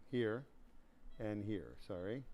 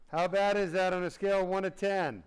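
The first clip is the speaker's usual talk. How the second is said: louder and higher pain check; loud question; 5 semitones higher, 7 dB louder than usual. Real, not acted. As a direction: call the question to a groggy man. Asks sedated patient pain score.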